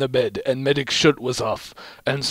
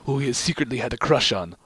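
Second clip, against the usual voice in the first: usual speaking voice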